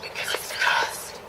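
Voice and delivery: hushed stage whisper. Acted. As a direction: whisper it like a secret.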